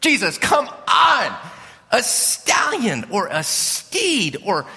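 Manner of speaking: high, slow, exasperated pleading; whining disciples. Retold or imitated. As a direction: beg him to pick a real horse. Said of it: "you could just imagine the disciples".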